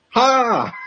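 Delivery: sudden high, loud burst; retold shout. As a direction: re-enact a shout mid-work, high and loud. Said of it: "I would just shout".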